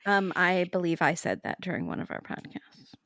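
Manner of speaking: unvoiced conspiratorial aside; co-host whispering a catty side remark. Real, not acted. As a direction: whisper it like a secret between co-hosts. Sly aside between co-hosts.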